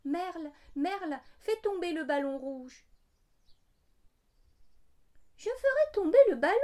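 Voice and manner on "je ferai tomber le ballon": high, fast bird voice; blackbird voice. A narrator's reading. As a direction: chirp it out like a bird. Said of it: "répond le merle".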